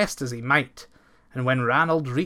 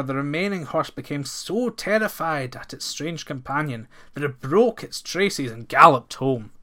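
usual speaking voice

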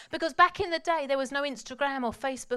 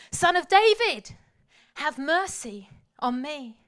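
1 desperate cry; 3 semitones higher, 8 dB louder, slower than usual. Retold or imitated. Bartimaeus crying out to Jesus.

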